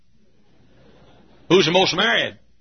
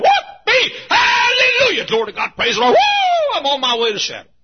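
high, loud whooping hallelujahs; ecstatic shouting praise; 8 dB louder than usual. Pretend. Preacher mocking a joyful flyer, ecstatic high shouts. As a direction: shout for joy like a revival. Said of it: "I could have gotten on a plane and said".

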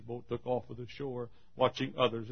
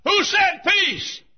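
high, shouted, drawn-out question; crying out; 13 dB louder, much slower than usual. Acted. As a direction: cry it out from a sickbed at full force. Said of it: "and cried".

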